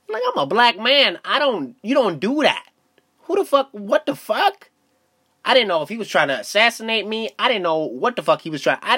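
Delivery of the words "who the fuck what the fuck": high baffled outburst. Retold or imitated. Retold alarm, high and drawn.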